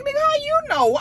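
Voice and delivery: high mock auntie voice, demanding; Auntie squeal. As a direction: pitch way up to mock a nosy auntie.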